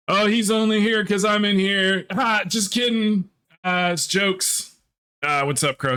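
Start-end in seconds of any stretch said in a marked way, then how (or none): 0.1-4.8 sing-song it as a playful tease; high teasing voice. Retold or imitated. Streamer imagines Stabby teasing in a high mocking voice. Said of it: "You went".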